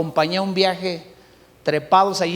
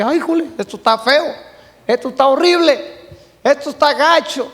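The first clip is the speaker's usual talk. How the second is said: mock alarmed pastor; 4 semitones higher than usual. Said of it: "el pastor se asusta y dice".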